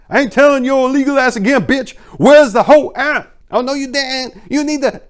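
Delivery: loud, high, furious yelling; bully your way through the door; threatening shout. A narrator's reading. Intruder yelling at Gabriella at the door, loud and furious.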